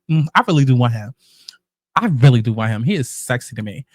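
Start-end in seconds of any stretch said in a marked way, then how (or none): none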